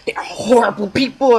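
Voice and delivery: imitating a friend's whisper; hushed warning. Friend whispers a warning about buying the album.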